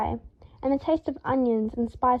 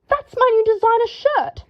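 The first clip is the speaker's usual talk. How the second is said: high, loud outraged howl; high, loud indignant howl; 11 semitones higher, 6 dB louder than usual. A narrator's reading. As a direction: shriek it in horror at the ruined shirt. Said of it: "Draco howled".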